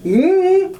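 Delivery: high, drawn-out mm-mm of refusal; mock refusal. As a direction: shut it down with a mocking no. Refusing, imitating herself.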